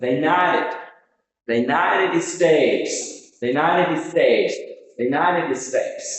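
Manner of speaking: United States drawn out slowly, "NITE" stretched, falling at the end; pronunciation model. Shown as a demonstration. Teacher drilling United States for learners, slow model delivery. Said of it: "will be pronounced".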